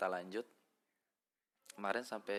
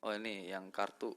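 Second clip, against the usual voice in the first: ordinary talk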